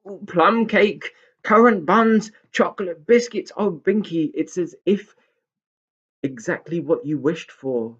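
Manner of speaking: excited listing. Acted. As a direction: gush over every treat. Pixie listing treats.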